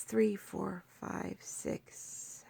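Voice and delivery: barely breathed, counting privately; whispered counting. Real, not acted. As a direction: whisper the numbers to yourself. Counting episodes under her breath.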